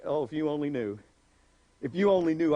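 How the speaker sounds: low, soft knowing reply; knowing smirk. Retold or imitated. Jesus answering Andrew with a knowing smirk, low. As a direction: smirk through it. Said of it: "I love the little smirk".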